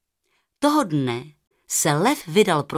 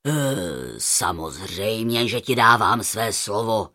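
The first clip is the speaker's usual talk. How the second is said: very low lion voice giving his word; deep, earnest lion promise; reassuring lion; 9 semitones lower than usual. A narrator's reading. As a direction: vow it deep and solemn. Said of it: "Přerušil ho lev".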